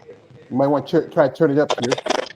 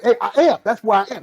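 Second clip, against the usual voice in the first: higher, louder emphatic retort; acted self-defence; 5 semitones higher, 4 dB louder than usual. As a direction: stand up for your title, raised and emphatic. Retells defending his emperor title.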